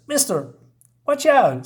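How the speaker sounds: high-pitched call of alarm; acted warning. Bystander warns Batman of danger, high mock-urgent call.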